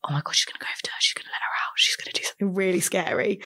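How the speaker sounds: put-on hushed, breathless whisper of childish dread; scared whisper. Young girl watching her auntie walk toward the woman, frightened whisper. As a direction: whisper it as if afraid she'll hear you.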